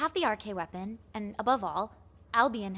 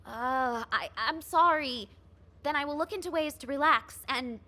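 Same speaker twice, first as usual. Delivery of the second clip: flustered apology; 5 semitones higher than usual. Acted. Flustered officer scolded.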